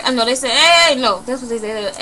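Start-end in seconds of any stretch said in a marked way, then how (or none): 0.5-1.0 loud, high, drawn-out hail; calling out. Retold or imitated. Men across the street hailing victim, calling out. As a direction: stretch the call to carry across a street.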